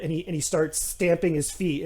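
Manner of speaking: ordinary delivery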